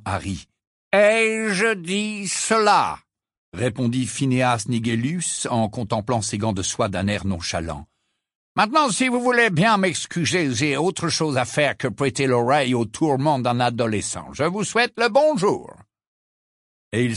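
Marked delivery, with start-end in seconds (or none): 0.9-3.0 very slow, higher, feigned innocence; coy portrait. Acted. Portrait evading Harry's question, slow and coy. Phineas coyly evades. 8.5-15.7 higher, dismissive farewell; a hint of the snobbish old headmaster; lightly voiced haughty portrait. Portrait bidding Harry a cold farewell, haughty. Phineas takes his leave.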